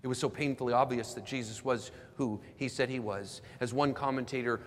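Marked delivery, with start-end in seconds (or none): none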